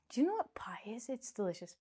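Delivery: unvoiced secret whisper; whispered aside. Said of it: "whispered aside about pie".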